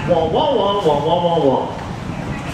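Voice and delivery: muted trombone wah-wah; imitating the Peanuts teacher voice; Charlie Brown adult wah. Shown as a demonstration. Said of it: "what did they hear me say".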